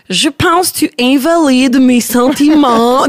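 overly earnest mock calm; mock therapy-speak. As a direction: parody a calm therapist phrasing. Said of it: "tu peux pas faire comme".

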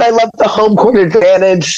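hoarse, failing voice; voice giving out. Real, not acted. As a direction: voice cracking and straining. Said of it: "Sorry, I'm losing my voice".

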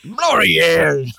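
loud hammed-up shout of L'Oreal; mock British shout. Joking about London, shouting in a mock British way. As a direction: belt it out like a cheesy ad.

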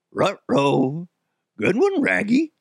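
cartoon talking-dog voice; growly R-heavy dog speech, leaping up high at the end; Scooby-Doo. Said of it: "Scooby-Doo and Shaggy".

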